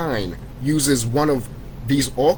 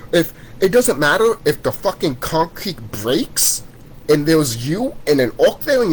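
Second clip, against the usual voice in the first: ordinary talk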